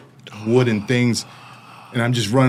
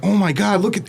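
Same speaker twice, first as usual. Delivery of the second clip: excited; 9 semitones higher than usual. Retold or imitated. Guards spot the escaped inmate.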